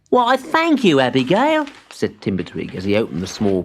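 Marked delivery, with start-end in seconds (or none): 0.1-1.7 put-on high, eager child-like voice; young tree voice. A narrator's reading. Timbertwig thanks his spider friend, high and eager. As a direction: sound small and pleased.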